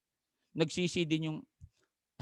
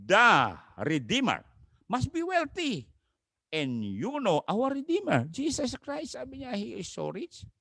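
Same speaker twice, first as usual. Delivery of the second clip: slow, lifted reverent proclamation; solemn preaching; 11 semitones higher than usual. Acted. Preacher to congregation, slow reverent proclamation. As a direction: draw out the Redeemer's name with awe.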